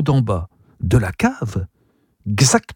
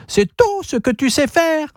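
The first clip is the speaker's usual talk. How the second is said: squawking parrot. A narrator's reading. Parrot speaks.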